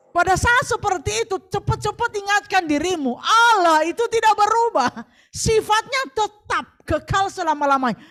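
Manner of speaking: forceful sermon; full preaching. Acted. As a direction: preach it with conviction.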